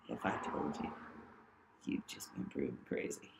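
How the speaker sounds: put-on a sinister old man murmuring a threat; creepy low voice. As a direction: sink low and hiss it like a creep. Retelling Jim's creepy threat.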